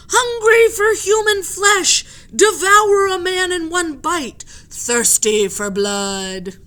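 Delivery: loud, slow, menacing chant of rumours; mob shouting. Acted. Sailors shout legends at the octopus, loud and slow. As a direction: growl out the scary legend.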